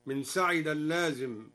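ordinary talk, nothing marked

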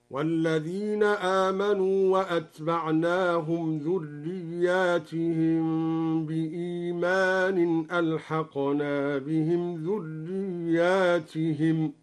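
وأتبعناهم with nun of majesty, ذرياتهم plural drawn out slowly; Duri reading demo. Shown as a demonstration. Teacher demonstrating the Duri reading of the verse, slow chant. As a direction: recite ذرياتهم slowly with the long alif after the ya. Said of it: "هكذا".

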